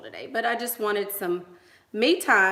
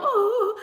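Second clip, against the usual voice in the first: mock ooh; 13 semitones higher, 4 dB louder than usual. Mimicking son's reaction.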